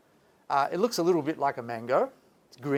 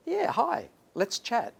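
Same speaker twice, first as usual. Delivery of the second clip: much higher, airy and chirpy; breezy chatbot voice; 9 semitones higher, slower than usual. Retold or imitated. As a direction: toss it off like a careless bot. Mocking chatbot's breezy reply.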